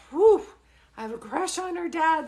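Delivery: high, loud mock swoon; hammed-up crush, starting on a big whoop; playful swooning. Medium confessing her reaction to client's handsome dad, playful swooning.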